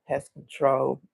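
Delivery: subdued imitation of a sad caller. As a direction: say it low-key and downcast. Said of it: "he was looking so sad".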